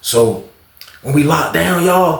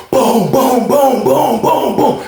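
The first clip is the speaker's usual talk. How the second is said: imitated door kicks; 9 semitones higher, much slower than usual.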